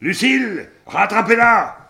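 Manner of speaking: high, loud call; urgent shout. Acted. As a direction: yell for her to catch it. Calling out urgently.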